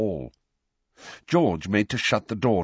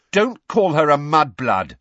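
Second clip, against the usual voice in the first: loud angry rebuke; 8 semitones higher, 8 dB louder than usual. A narrator's reading. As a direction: snap it out in anger. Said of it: "very angrily".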